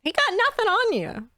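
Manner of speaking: a hint of a bright old innkeeper agreeing; innkeeper echo. NPC barkeep echoing player.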